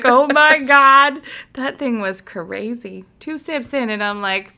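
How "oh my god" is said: slow, high, loud drawn-out gasp; tipsy surprise. Retold or imitated. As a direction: stretch it like the drink just hit.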